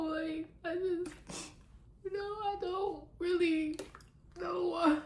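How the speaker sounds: high, teary hammed-up sobbing voice; mock crying. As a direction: fake a dramatic breakdown over zero sales, voice cracking up high. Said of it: "a little dramatic much".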